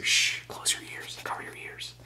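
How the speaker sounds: hushed whisper so the camera won't hear. Pretend. Whispering to the camera to keep it secret.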